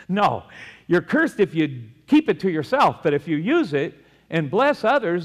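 loud, high, fervent preaching; preaching at full force. Acted. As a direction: drive it home to the congregation. Preaching loud about riches.

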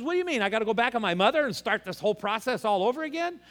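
high, disbelieving Nicodemus asking Jesus; incredulous question. Retold or imitated. Nicodemus asks Jesus in baffled disbelief. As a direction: play baffled, voice jumping up.

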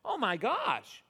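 hammed-up startled correction; mock surprise. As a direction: act as if you just caught the mistake. Catches his own misquote.

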